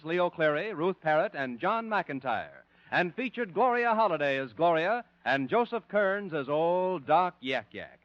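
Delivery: ordinary talk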